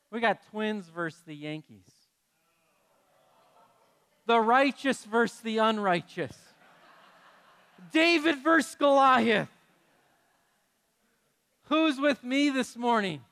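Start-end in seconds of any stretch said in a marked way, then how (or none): 4.2-13.2 building hype. Real, not acted. Hyping the rivalry to congregation.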